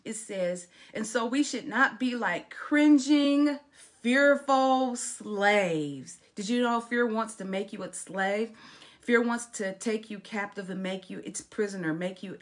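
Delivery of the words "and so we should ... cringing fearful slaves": higher, louder, drawn-out preaching; forceful pulpit delivery; emphatic scripture reading. Acted. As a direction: preach the verse, stretching the last words. Reads scripture while preaching.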